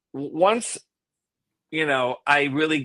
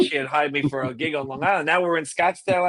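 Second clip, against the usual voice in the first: ordinary talk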